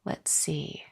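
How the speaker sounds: hushed muttering to herself; soft unvoiced murmur while reading comments; whispered aside. Real, not acted. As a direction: whisper it under your breath while scanning.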